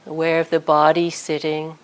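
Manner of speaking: calm guided body scan with long pauses; unhurried meditation voice, leaving space after each phrase. Real, not acted. Teacher guiding sitters through a body scan, calm meditation voice.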